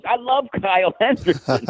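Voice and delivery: high, gushing fan exclamation; imitating a stranger gushing about the radio host; excited praise.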